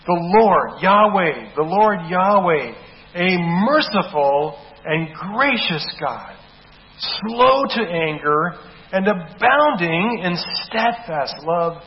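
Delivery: slow, loud, drawn-out scripture reading; booming preacher's slow recitation; solemn proclamation. Acted. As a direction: proclaim each name of God slowly.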